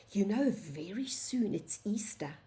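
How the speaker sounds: whispered, conspiratorial; secret whisper. Acted. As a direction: lean in and whisper it. Teacher confides Easter secret.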